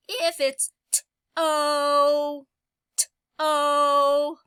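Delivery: teacher stretching the final O; stretched sound. Shown as a demonstration. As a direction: drag out the end O of t-o. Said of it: "O is the last sound".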